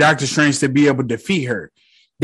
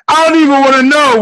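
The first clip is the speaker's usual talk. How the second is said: higher and louder, fed-up disbelief; exasperated; 10 semitones higher, 7 dB louder, faster than usual. Retold or imitated. Wong reacting to Strange in the corpse, loud exasperated disbelief. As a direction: throw it out like you've seen too much.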